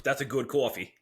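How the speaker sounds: COFFEE with a broad Long Island "aw"; mock Long Island coffee lover; Long Island accent. Shown as a demonstration. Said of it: "specific words of a certain hot".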